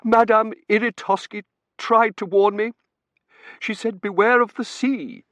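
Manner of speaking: high, loud theatrical lament; wailing mother. Acted. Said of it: "and cried".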